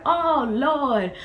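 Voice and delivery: loud, high, drawn-out dismay; imitating grandma's high-pitched exclamation; exasperated grandmother. Grandmother's alarm at seeing her carried.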